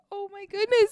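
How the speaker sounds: high, loud mock dismay; imitating her own shock. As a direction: gasp it out in disbelief. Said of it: "and I'm like".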